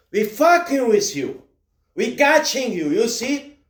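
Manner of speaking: usual speaking voice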